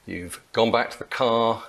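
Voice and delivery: ordinary talk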